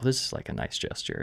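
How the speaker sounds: soft, whispery inner voice; thinking to oneself. Retold or imitated. Passenger offering cash to a taxi driver, thinking to himself, hushed. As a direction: say it under your breath like a private thought. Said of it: "I was thinking to myself".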